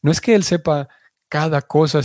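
usual speaking voice

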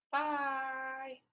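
drawn-out, high singsong goodbye; cooing goodbye. Real, not acted. Podcaster seeing her cat out, cooing goodbye. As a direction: sing it sweetly to a pet.

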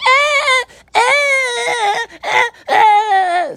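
high squealing fan cries; mock swooning squeal. Vlogger imitates girls in the crowd, high swooning squeal. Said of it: "Girls in the crowd, like".

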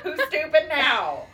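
mock taunt at mom; mock gloating. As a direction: gloat through a laugh. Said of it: "You said annoyed, Mom".

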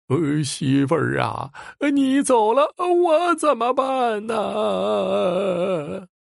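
slow, high keening sob; wailing in grief. A narrator's reading. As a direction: drag each word out as a wail. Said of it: "顿时是悲声大放".

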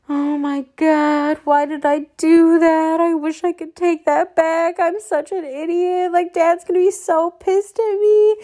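high, frantic self-scolding whine; panicked. Retold or imitated. After scraping dad's leased truck, high panicked whine. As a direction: freak out in a squeaky panic.

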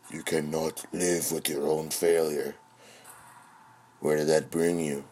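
low, measured villain quote; imitating film villain. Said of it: "He's like".

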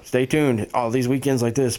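ordinary delivery

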